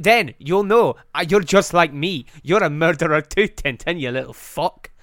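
menacing Scottish growl of a killer; hammed-up Scottish villain; angry Scotsman. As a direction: goad him in a thick Scottish accent. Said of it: "he's a really angry Scotsman".

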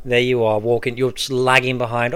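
ordinary talk

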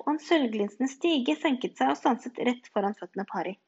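usual speaking voice

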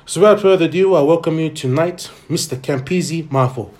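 big announcer build to the name; grand entrance announcement. Acted. Host announcing guest's entrance.

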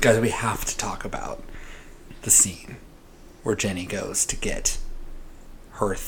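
hushed, secretive whisper. Real, not acted. Whispers about the scene.

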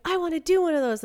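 bright excited longing; imitating her own eager self; eager wish. Eager wish for photo shoot.

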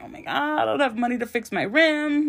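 high-pitched mock panic; freaking out. Car owner told her rim is bent, freaking out. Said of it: "And I'm freaking out".

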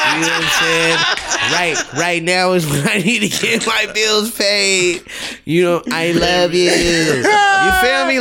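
slow, wheedling plea for bill money; sweet-talk, then jump high on "feel me"; needy girlfriend voice. Retold or imitated. Continues the needy caller impression.

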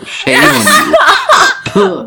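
high, slow laughing scold; mock scolding, laughing. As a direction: drag it out through laughter. Mock scolding after bad accent.

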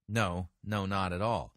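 ordinary talk, nothing marked

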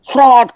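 high, forceful gushing-tap noise; imitated water gush. Doctor demonstrates high tap pressure, imitated strong gush. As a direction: burst out the sound of water spurting. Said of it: "kenceng gitu ya".

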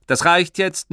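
high, loud sharp rebuke; stern father. Acted. Father cutting off his son, loud and stern. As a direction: cut the boy off firmly.

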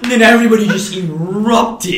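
loud, high-pitched burst; big thrilled shout of a storyteller; excited climax. Real, not acted. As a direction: explode with it like the crowd did.

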